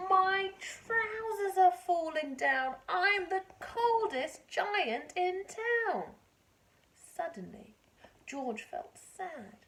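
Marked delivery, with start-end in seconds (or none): 0.1-6.2 dismayed giant. Acted. George's trousers fall.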